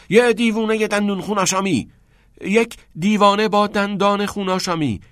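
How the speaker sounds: high, urgent prompting. A narrator's reading. Teacher feeds pupil's line straight back, urgent prompting. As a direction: jump straight in to keep him going. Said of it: "کتینگ سریع و بیدرنگ گفت".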